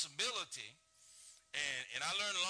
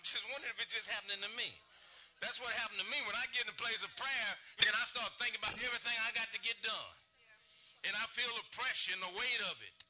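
high, loud preaching voice; full-force preaching; 6 semitones higher, 4 dB louder than usual. Acted. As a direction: preach it out to the pews. Preaching with raised voice.

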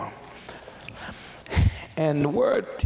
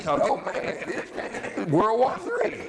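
drawn-out comic dismay rising high; laughing, exaggerated disbelief; mock alarm; 8 semitones higher, much slower than usual. Someone caught in rough family talk, in mock alarm.

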